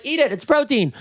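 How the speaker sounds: high, frantic comeback; mock retort. Storyteller answering the appalled diners, high frantic retort. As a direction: toss it back high in mock panic.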